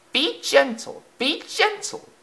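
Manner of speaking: very high pleading chant of worried coaches; crying coaches. Acted. Coaches cry out to the rough engine, high and pleading. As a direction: beg gently in a tiny high voice. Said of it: "cried the coaches".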